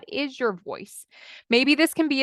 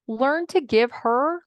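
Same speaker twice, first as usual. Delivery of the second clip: ordinary talk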